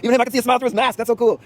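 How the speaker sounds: very high, loud gushing excitement; over-excited squeal of delight; delighted exclaiming. Retold or imitated. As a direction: call out in giddy amazement. Acting out his delighted call to crowd.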